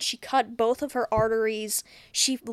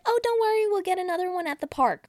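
mock cheery mom; 8 semitones higher, 4 dB louder, faster than usual. Mom reassuring child, voiced.

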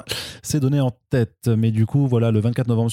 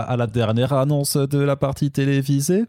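put-on slow, high Alsatian accent; drawn-out comic Alsace accent, pitched up; eastern French accent; 4 semitones higher, slower than usual. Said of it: "Je vais pas faire l'accent de l'Est".

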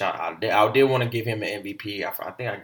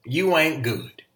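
mock scolding wife; 5 semitones higher, 6 dB louder than usual. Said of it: "Obviously she said".

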